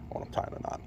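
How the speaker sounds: mumbled through clenched teeth; talking through teeth. Real, not acted. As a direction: speak through teeth while working a knot. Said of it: "That's why I'm talking through my teeth".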